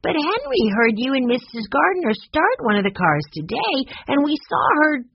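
put-on high-pitched, protesting child; indignant little boy. A narrator's reading. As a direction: blurt it out like an outraged small boy. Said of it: "This was too much for Benny".

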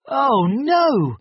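high, loud "oh no"; dismayed cry. A narrator's reading. Narrator reacting as the raft breaks again, dismayed.

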